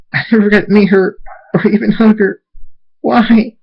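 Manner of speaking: high, grief-stricken wail; anguished screaming. A narrator's reading. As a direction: cry out for the lost mother. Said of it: "Scootaloo screams".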